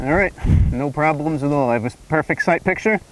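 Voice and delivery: muffled behind a gas mask; talking inside a respirator mask; through a gas mask. Real, not acted. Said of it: "go ahead and put this gas mask on".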